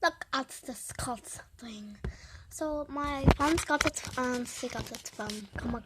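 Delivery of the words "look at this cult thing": whisper. Real, not acted. Whispering to camera about toy.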